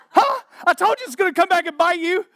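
high, loud, fast I-told-you-so; gloating Reuben. Retold or imitated. Reuben scolding his brothers on the way home, loud gloating. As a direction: rub it in their faces. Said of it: "Reuben on the way home be like".

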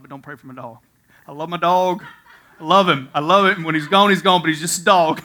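loud, insistent imitation of the neighbor; emphatic retold plea. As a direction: plead loudly for the dog, half imitating. Neighbor insisting about his dog.

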